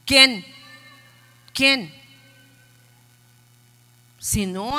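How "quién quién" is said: emphatic loud question. Real, not acted. Pastor pressing a rhetorical question.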